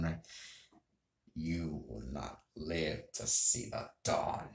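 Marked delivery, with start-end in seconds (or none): 1.3-4.5 slow, hushed, deep villain voice; Wesker. Acted. Gamer retrying a game villain's line, soft lowered Wesker imitation. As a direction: draw the threat out low and soft. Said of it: "If I just lower my voice a bit".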